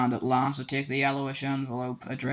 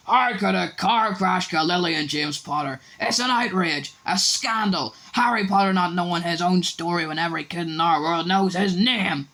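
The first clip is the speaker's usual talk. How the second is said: loud, higher indignant shouting; outraged; 5 semitones higher, 5 dB louder than usual. A narrator's reading. Hagrid furious at the Dursleys, loud and outraged. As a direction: rage at the injustice. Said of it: "jumping up so angrily".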